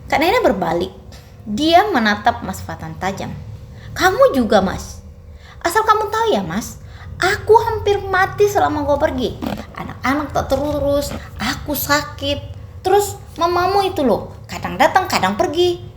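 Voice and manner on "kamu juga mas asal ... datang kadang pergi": higher, raw complaint thrown at him; bitter accusation. A narrator's reading. Naina confronts her husband Fatan, bitter and higher. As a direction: pour out the hurt as an accusation.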